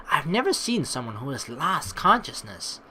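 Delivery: ordinary talk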